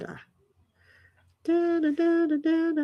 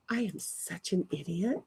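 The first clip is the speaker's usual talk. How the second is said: much softer, but with voice; quiet self-scold; 3 semitones lower, 14 dB quieter than usual. Real, not acted.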